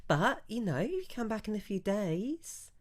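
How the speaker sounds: the merchant apologises, bright and eager; shopkeeper voice. Acted. Branderi apologises to the shoppers, high and chatty.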